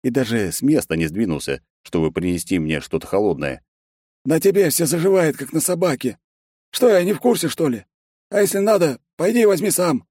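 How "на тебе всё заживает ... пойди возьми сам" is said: high-pitched, dismissive character voice; cheeky secretary. A narrator's reading. Secretary refusing to help the sore boss, high and dismissive. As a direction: brush off the complaint, high and cheeky.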